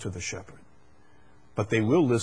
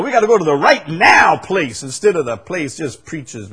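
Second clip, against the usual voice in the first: fiery preaching; 7 semitones higher, 9 dB louder than usual. Acted. Preaching at full force.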